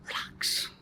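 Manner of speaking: soft, voiceless whisper. A narrator's reading. Nervous guest to himself at the door, whispering. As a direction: whisper it to steady yourself. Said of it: "and whisper".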